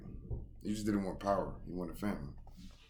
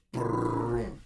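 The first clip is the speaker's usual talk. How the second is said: a loud rolling brrrr with the lips; imitated stumble noise; 5 semitones higher, 6 dB louder than usual. Reader imitates the stumble, buzzing brrrr. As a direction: buzz the lips hard like a body tumbling.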